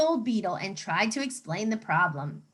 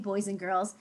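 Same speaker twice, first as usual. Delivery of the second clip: ordinary talk, nothing marked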